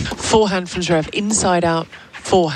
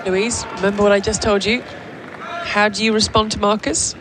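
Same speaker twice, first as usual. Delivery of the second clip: usual speaking voice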